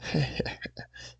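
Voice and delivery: breathy whispered horror-host laugh; a sly spooky snicker; low wicked chuckle; creepy host chuckle. Acted. Horror host greets the kiddies.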